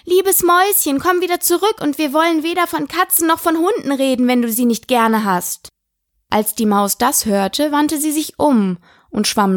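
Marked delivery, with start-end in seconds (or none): 0.0-5.7 high, affectionate coaxing call; tender calling. A narrator's reading. Alice calling after the fleeing mouse, tenderly. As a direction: call sweetly to lure someone back. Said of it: "Sie rief ihr daher zärtlich nach".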